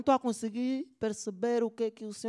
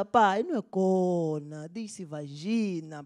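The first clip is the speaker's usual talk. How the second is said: slow correction; 3 semitones lower, much slower than usual. Acted. Son slowly correcting his father.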